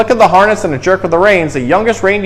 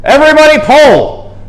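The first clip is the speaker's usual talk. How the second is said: high, loud call to pull; rally the team with a big shout; 11 semitones higher, 6 dB louder than usual. A narrator's reading. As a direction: Santa shouting to the straining reindeer. Santa urging reindeer to pull.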